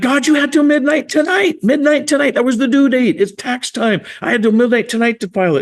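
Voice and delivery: frantic complaint about the tax deadline; exasperated outburst. Retold or imitated. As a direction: insist on the deadline, high and exasperated. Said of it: "I'm like".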